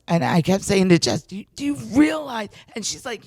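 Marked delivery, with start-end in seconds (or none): 1.2-2.5 high, quick, excited retold question; starstruck disbelief. Fan pressing Jess about the star, starstruck disbelief. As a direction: squeal it in mock disbelief.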